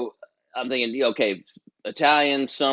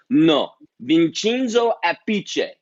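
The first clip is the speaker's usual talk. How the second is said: loud, slow, higher Italian correction; Italian manager's accent; 5 semitones higher, 5 dB louder, slower than usual. Retold or imitated. Italian manager correcting the drummer's name, in an Italian accent. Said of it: "He's like".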